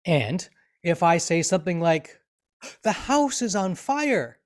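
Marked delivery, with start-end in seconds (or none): 2.8-4.4 high, slow, drawn-out cry of alarm; raised pitch, stretched words, full of emotion; excited exclamation. Shown as a demonstration. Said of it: "you can hear by the excitement of my voice".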